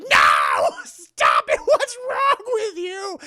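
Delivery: loud, hammed-up dad outburst; imitated horrified yell. Imitates father yelling at Esther.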